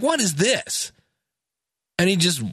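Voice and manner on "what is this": high, incredulous question; walk in and can't believe your eyes; Jesus, finding a market in church, aghast; shocked outrage. Retold or imitated. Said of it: "You're like".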